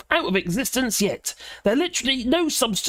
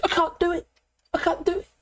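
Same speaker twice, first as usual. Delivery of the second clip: imitating a tearful, higher whimper; mock whimper; 8 semitones higher, faster than usual. Mimicking Michelle Mone, tearful and higher. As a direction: act out her crying can't-do-it. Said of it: "She looked like she's gonna cry".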